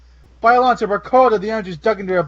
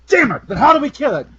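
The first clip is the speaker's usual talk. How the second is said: frustrated; 6 semitones higher than usual. Acted. Pilot frustrated outburst.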